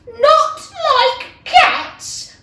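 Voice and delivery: high, loud, slow outraged squeak; indignant cry. Acted. The offended mouse cries out at Alice, high and outraged. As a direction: shriek it in disbelief, drawn out. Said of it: "cried the mouse".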